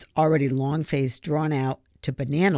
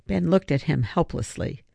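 usual speaking voice

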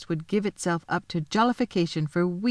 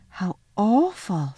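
slow, drawn-out dismay; mildly shocked little girl; Lucy, appalled; slower than usual. A narrator's reading. Girl reacts.

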